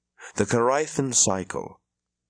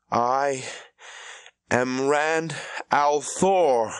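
slow, higher, every word weighed; defiant assertion; 6 semitones higher, much slower than usual. Acted. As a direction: claim your own name against the truth. Rand asserts his identity.

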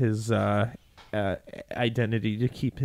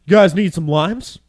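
imitating coworker; 5 semitones higher, 9 dB louder than usual. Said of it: "out of the blue, he's like".

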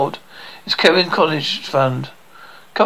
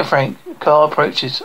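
usual speaking voice

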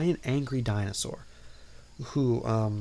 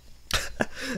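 laugh. Real, not acted. Laughing at the scene.

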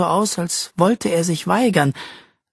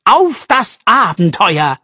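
slow, louder and higher, bursting with excitement; slow excited toast, louder and higher; enthusiastic toast; 5 semitones higher, 6 dB louder, slower than usual. Acted. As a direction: raise a toast full of enthusiasm. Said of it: "rief er".

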